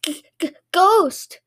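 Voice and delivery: high-pitched, stuttering fright; alarmed stammer. A narrator's reading. Shop owner sees floating lips stealing his buns, alarmed stammer. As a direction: stammer it high, scared of a ghost. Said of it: "he shouted".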